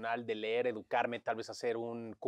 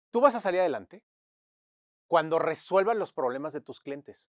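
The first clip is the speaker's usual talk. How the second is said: louder, higher emphatic scolding; mentor's scolding; 3 semitones higher, 9 dB louder than usual. Retold or imitated. Client scolds a young consultant by phone, emphatic scolding. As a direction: scold firmly, then drive the lesson home.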